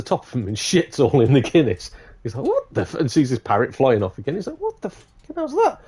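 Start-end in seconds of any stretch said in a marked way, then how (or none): 2.5-2.9 high-pitched acted outburst, cut short; shocked disbelief. Customer seeing his Guinness fouled, shocked high yelp. Customer reacts to parrot mess. 4.6-5.8 very high, acted incredulous question; shocked disbelief. Customer watching the parrot fly off, high incredulous shock.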